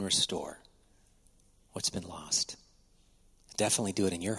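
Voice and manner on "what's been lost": whispered promise. Real, not acted. Hushed promise of restoration.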